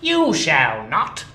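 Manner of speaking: loud, slow and firm; stern refusal. Acted. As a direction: shut the clerk down coldly. Scrooge refuses clerk.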